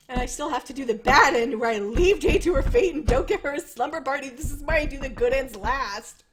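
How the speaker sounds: upset complaint. Real, not acted. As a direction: protest loudly, upset by the story. Upset about the bad ending.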